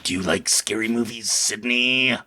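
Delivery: low, menacing phone-killer voice; Scream phone killer. Retold or imitated. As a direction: creep them out like Ghostface on the line. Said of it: "this guy on the phone calls on Scream".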